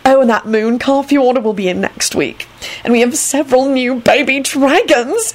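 high, confiding, excited; loud stage whisper. A narrator's reading. Antonin sharing news with Neville, mock-whispering loudly. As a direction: confide it like delicious gossip. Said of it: "he whispered loudly".

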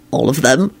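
plain reading voice

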